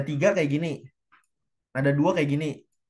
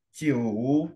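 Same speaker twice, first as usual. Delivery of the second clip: "jiu" drawn out, falling then climbing; full third tone; 3 semitones lower than usual. Shown as a demonstration. Teacher shows how counting sounds odd, "jiu" long and dipping. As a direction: say "jiu" with the whole dip and rise. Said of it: "karena lo sebutin nada tiganya panjang".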